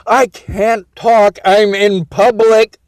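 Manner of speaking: slow, careful phone-dictation voice; slow deliberate. Shown as a demonstration. Dictating to the phone slowly, overly clear. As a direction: spell it out for the phone. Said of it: "talking like this".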